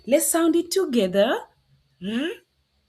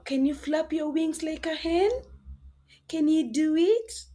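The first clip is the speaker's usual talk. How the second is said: normal talking voice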